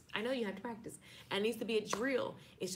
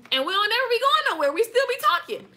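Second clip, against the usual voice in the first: loud, much higher, laughing through it; 8 semitones higher, 12 dB louder than usual. Real, not acted. Teasing viewers about never leaving, loud and laughing. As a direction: cackle the joke out loud.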